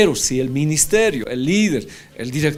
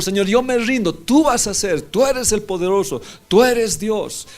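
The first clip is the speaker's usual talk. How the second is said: fervent loud prayer; 7 semitones higher, 4 dB louder than usual. Pretend. Preacher voicing his prayer of surrender to God, loud and fervent. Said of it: "Le digo".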